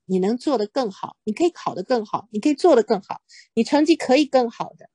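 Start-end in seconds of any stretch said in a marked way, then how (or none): none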